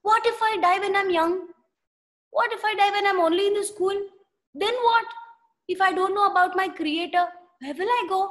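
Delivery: fast, high, anxious rush of questions; worried boy. Acted. Young Sundar fretting about dying before knowing God, fast and anxious. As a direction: let the fear spill out quickly.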